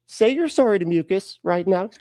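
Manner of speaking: mock exasperated parent; scolding parent. Jesus scolds Peter like parent.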